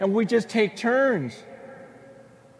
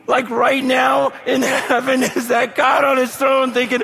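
excited preaching; 5 semitones higher than usual. Real, not acted. Preacher building to a climax.

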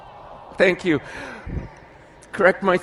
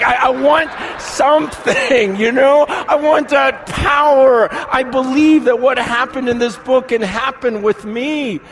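loud, high, fervent cry; impassioned yearning; 8 semitones higher, 5 dB louder than usual. Retold or imitated. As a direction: cry out with urgent longing. Acting out craving God's power.

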